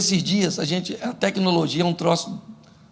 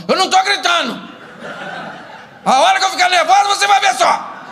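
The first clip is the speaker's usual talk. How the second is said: high, loud denial of shouting; comic shrieking outburst, pitched way up; mock wife yelling; 12 semitones higher, 4 dB louder than usual. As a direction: act out a nervous wife raising her voice. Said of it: "começa a aumentar o volume".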